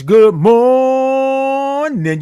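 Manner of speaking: high, slow sung-out greeting; long O's in morning. Real, not acted. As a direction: stretch morning on one held high note. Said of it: "I love all those O's and R's".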